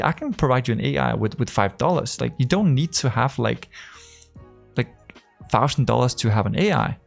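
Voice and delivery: usual speaking voice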